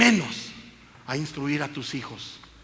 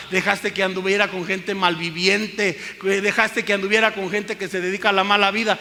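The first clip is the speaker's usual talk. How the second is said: loud, higher accusing preacher; louder and higher with each repeated question; scolding rebuke; 5 semitones higher, 8 dB louder than usual. Acted. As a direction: scold the careless mother hard.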